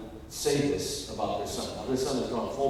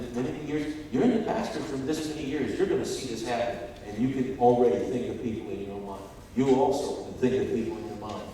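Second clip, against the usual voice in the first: ordinary talk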